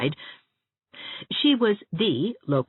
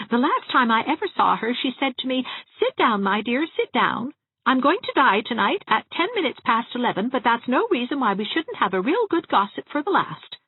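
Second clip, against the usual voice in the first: put-on higher, chatty gossiping old dressmaker; higher-voiced prim spinster relishing every funeral; Miss Valentine; 9 semitones higher than usual. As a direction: gossip cheerfully about the dead.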